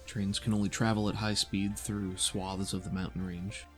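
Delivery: ordinary talk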